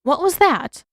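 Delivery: a hint of an alert old healer; lightly voiced, suddenly wary; sharp alarm. A narrator's reading. Yugoda hearing a tap at the door, lightly voiced in sharp alarm. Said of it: "Yugoda's gaze sharpened".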